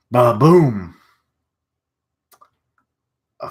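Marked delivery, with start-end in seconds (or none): 0.1-1.0 loud, punchy spoken explosion; imitated explosion boom. Reader sounding out the propane tank blowing, loud boom. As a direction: boom it out like a blast.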